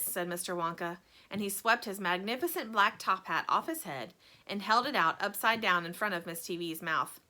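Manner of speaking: usual speaking voice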